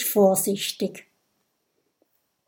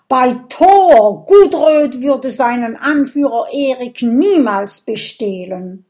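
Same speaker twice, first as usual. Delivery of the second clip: loud, higher, voice shaking with anger; trembling angry cry; 4 semitones higher, 8 dB louder than usual. Acted. Viking protests his innocence, loud trembling outrage. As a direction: swear it by Thor, outraged. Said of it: "ruft mit bebender Stimme".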